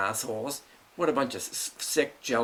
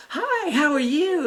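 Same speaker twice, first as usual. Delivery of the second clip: high, slow, sugary-sweet greeting, much higher; fake friendly sister; 13 semitones higher, 5 dB louder, slower than usual. Sister stepping in the door, greeting him with fake sweetness. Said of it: "Oh, so friendly and smiley and nice".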